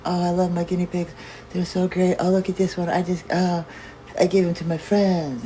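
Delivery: high, sweet gushing voice; gushing lady. Retold or imitated. Imitating her cooing over pets, high and gushing. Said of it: "I thought, like".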